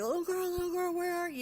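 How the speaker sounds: high-pitched searching call, voice lifted far up. Retold or imitated. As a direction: call out for a lost child. Woman searching for lost child.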